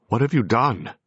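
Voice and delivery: loud, high demand of a friend who cannot hear. A narrator's reading. A ghost demanding answers from the medium, loudly. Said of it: "I demanded".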